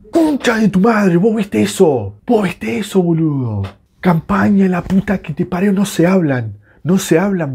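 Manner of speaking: angry high-pitched rant; indignación furiosa. Real, not acted. Rages at defensive blunder. (puteada indignada, con voz aguda)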